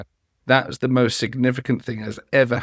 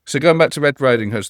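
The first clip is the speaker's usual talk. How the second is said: ordinary delivery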